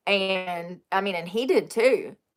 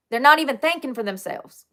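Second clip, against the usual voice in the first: usual speaking voice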